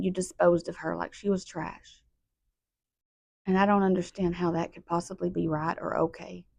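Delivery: very soft, drained grief; hushed, sorrowful accusation sinking into pleading; pained sadness. Real, not acted. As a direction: plead quietly from deep hurt. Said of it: "pain and sadness".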